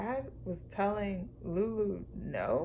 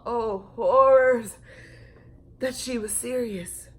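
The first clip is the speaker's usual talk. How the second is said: mock horror; 3 semitones higher, 8 dB louder than usual. A narrator's reading. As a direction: ham up the shock. Dramatic narration.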